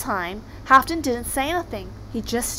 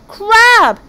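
high, very loud, drawn-out retort; arguing shout; 11 semitones higher, 13 dB louder than usual. Acted. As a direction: win the squabble by sheer volume. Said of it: "They were so busy arguing".

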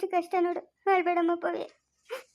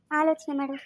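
ordinary delivery

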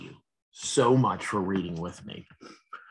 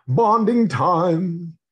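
slow, louder, higher happy drop voice; cozy character line; 6 semitones higher, 6 dB louder, much slower than usual. Acted. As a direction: savor it like a group hug. Drop voiced meeting her friends.

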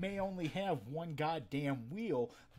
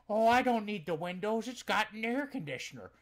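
customer's voice; 7 semitones higher, 8 dB louder than usual. Retold or imitated. Customer brushing off problems.

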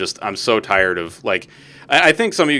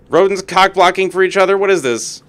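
higher and louder incredulous rant; indignant higher cry at rodents ganging up; indignant outburst; 9 semitones higher, 6 dB louder than usual. Real, not acted. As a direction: protest at the rodents.

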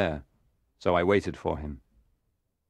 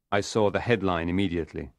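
plain reading voice

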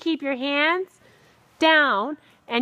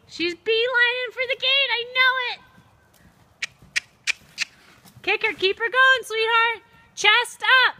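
excited urging; 6 semitones higher than usual. Real, not acted. Instructor urging horse and rider.

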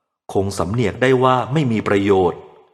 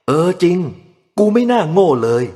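self-reproach; 8 semitones higher, 4 dB louder than usual. A narrator's reading. Father scolding himself.

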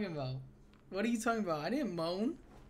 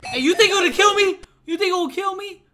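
much higher and louder, rushed; panicked fast question; 10 semitones higher, 10 dB louder, faster than usual. Real, not acted. Gamer asking chat if the monster will kill him, panicked. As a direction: blurt it out in panic.

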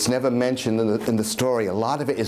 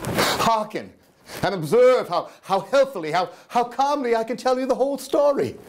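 high, rising manic pleading; manic insistence; 9 semitones higher than usual. Acted. Madman urging listeners to hear his calm, voice high. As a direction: sound calm but crack upward.

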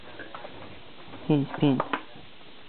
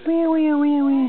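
high, bouncy repeated where; 8 semitones higher, 3 dB louder, slower than usual. Real, not acted. Playful baby game.

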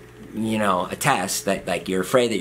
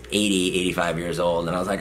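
ordinary delivery

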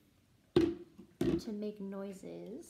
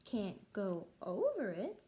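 usual speaking voice

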